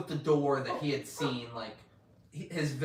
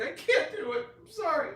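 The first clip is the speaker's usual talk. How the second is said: imitated crying; 6 dB louder than usual. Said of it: "he's crying".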